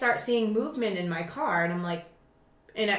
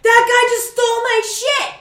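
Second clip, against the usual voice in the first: high, loud outburst; breathless yell; 13 semitones higher, 8 dB louder than usual. Retold or imitated. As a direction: yell it on the run. Shouting explanation while chasing.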